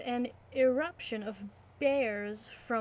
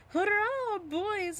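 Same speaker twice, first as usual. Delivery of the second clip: higher cheerful hurrah call; cheering; 9 semitones higher, slower than usual. Acted. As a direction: call out to the crew. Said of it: "The landlord cried".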